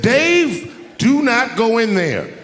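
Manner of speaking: high, loud, slowed plea; urgent warning. Retold or imitated. Worried producers warning Dave off the kitchen, high and loud. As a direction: beg him not to go in.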